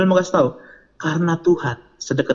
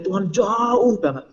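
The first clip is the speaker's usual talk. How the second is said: "jauh" stretched long and raised in pitch; drawn-out JAUH; 5 semitones higher, much slower than usual. Acted. Preacher mimicking youth's thought, stretching "jauh". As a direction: drag out the word "jauh".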